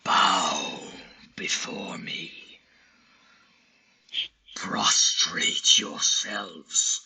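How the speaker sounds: slow breathy command; menacing creature. Acted. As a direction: hiss the order down at them. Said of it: "it says".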